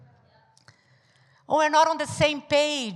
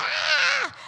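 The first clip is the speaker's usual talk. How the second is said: mock shriek; 12 semitones higher than usual. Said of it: "we're like".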